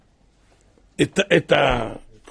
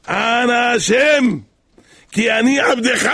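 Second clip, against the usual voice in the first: higher, emphatic declaration to God; intended cry; 5 semitones higher, 4 dB louder, slower than usual. Retold or imitated. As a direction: call out firmly, drawing out the first words. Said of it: "לא פה התכוונתי". (קריאה נחרצת וגבוהה אל השם)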